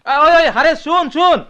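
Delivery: loud, high call after someone walking away. Acted. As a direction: shout to stop him leaving. Calling after leaving Hares. (চলে যাওয়া ভাইকে জোরে ডাকা)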